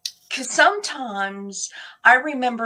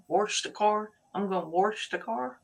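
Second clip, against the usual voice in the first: put-on "wash" said as "warsh", Missouri accent, with an r; imitating Missouri folks, "warsh the car"; 5 dB quieter than usual. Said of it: "Missouri that says wash instead of wash".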